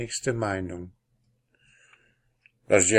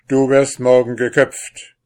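a louder angry snap; snapping angrily; 5 semitones higher, 10 dB louder than usual. Acted. The king snaps at the prisoner, loud and angry. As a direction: bite the words at him like an angry king. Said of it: "fuhr ihn der König an".

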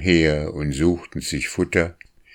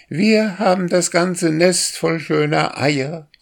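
much higher, bird-like sing-song; starling trills to his mate, high and bird-like; chirping starling song; 12 semitones higher, 3 dB louder than usual. A narrator's reading. Starling boasts of his nest in a high bird sing-song. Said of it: "sang der Star".

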